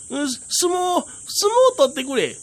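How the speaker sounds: high, eager, stammering plea; small tengu pleading. Acted. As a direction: beg like an excited little imp. 小天狗が相撲を頼む. (甲高くせがむ小天狗)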